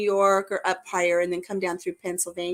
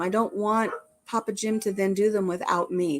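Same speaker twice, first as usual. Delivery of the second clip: ordinary talk, nothing marked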